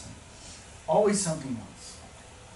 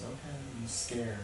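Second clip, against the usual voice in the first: ordinary talk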